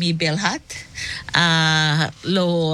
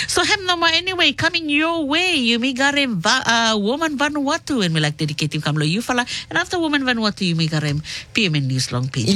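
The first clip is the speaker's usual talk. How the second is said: higher, upbeat show-host read; radio announcer; 6 semitones higher than usual. Acted. Radio host introducing the next shows, in a bright announcer voice. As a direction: announce the next programmes to listeners.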